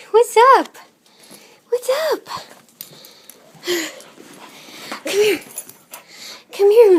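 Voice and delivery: high, squealy voice for a dog; excited dog talk. Real, not acted. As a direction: gush over the dog, high and bright.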